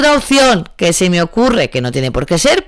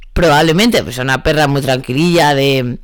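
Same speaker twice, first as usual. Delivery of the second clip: ordinary talk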